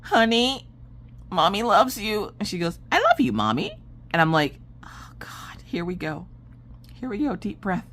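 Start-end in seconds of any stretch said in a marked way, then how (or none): 0.0-2.3 high, careful sweet talk; butter up the child gently; coaxing mommy voice. Retold or imitated. 2.9-3.8 high imitation of a young daughter; little girl voice. 4.8-7.9 soft, breath-held dread; whispered self-bracing. Retold or imitated. Bracing self before tantrum.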